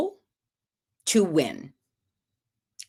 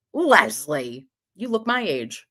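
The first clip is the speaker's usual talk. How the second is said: usual speaking voice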